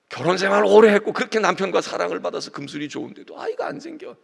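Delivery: ordinary delivery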